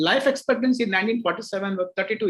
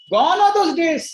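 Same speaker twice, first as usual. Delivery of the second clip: emphatic exclamation; 10 semitones higher, 6 dB louder than usual. Real, not acted. Emphatic declaration to audience.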